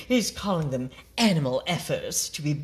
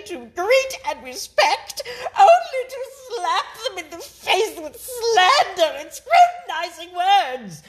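laughing while reading; 13 semitones higher, 7 dB louder than usual. Real, not acted. Reading the email, cracking up.

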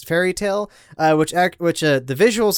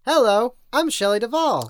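Shelley Duvall impression; 6 semitones higher than usual. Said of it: "I love that thing".

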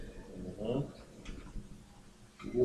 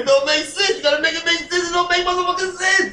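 riled-up disbelief about the sneakers; exasperated rant; 12 semitones higher than usual. Real, not acted. Ranting about overpriced sneakers.

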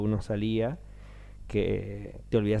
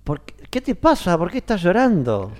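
high, loud concerned question; Lino asking; 9 semitones higher, 9 dB louder than usual. Retold or imitated. Producer asking the crying actor why, high and loud. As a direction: ask "why are you crying" in a much higher voice. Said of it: "me dice Lino".

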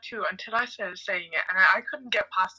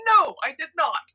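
indignant; 8 semitones higher, 7 dB louder than usual. Pretend. Mock indignant rhetorical answer.

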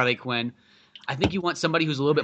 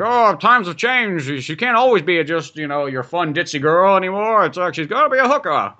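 a hint of a complaining old man, pitched up; lightly voiced old man; 4 semitones higher, 4 dB louder than usual. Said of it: "like, old man".

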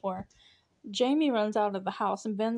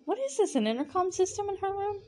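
much higher, mock-baffled question; incredulous; 10 semitones higher than usual. Real, not acted. Reacting to the show's intercom moment, incredulous and high. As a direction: pitch shoots up in disbelief. Incredulous at phone call scene.

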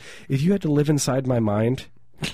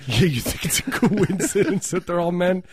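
mock incredulous; 7 semitones higher than usual. Mocking the partner's question.

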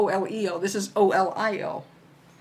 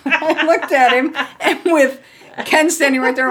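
ordinary delivery

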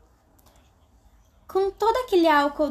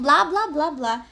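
usual speaking voice

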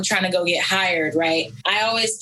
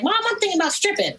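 telling mom; 13 semitones higher, faster than usual. Retold or imitated. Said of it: "I was like".

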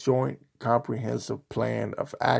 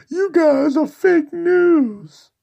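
much higher and louder, slow whining parody; mock whiny viewer; 14 semitones higher, 8 dB louder, slower than usual. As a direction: whine it like a sore fan. Mocking crying Fox viewers.

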